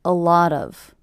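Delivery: "A lot of" in one unit, stressed on "lot"; stress on middle word. Shown as a demonstration. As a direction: lean on "lot", keep "A" and "of" light. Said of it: "stress happening on the middle word".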